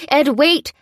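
urgent cry. A narrator's reading. Lucy calling her partner back, loud cry. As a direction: shout after him to wait. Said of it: "cried Lucy".

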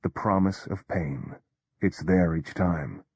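ordinary delivery, nothing marked

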